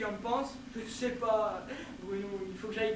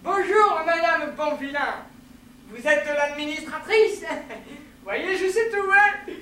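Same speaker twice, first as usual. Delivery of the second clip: hearty greeting; 3 semitones higher, 7 dB louder than usual. Acted. Newcomer greeting the administrator, loud and hearty. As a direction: announce yourself boisterously.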